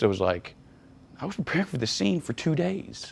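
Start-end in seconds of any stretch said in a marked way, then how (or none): 1.2-3.1 annoyed retort. Retold or imitated. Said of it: "And I kinda got pissed".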